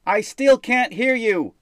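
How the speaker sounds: high, loud, slow shout. A narrator's reading. Jaden yells again at the demon, slow loud shout. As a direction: bellow it across the sky.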